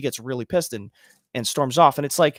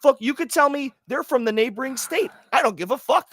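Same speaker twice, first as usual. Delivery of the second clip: high-pitched, emphatic outburst; heated rant; 9 semitones higher than usual. Acted. Dismissing people from the neighboring state, heated and high. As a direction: wave away the objection with heat.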